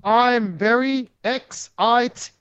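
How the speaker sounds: slow, stretched words like a busted AI robot; mock robotic pacing. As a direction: imitate his odd halting promo delivery. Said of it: "the weird pacing".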